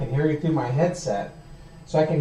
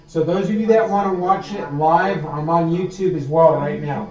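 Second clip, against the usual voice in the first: announcing loudly; 4 semitones higher, 8 dB louder than usual. Real, not acted. Announcing to viewers.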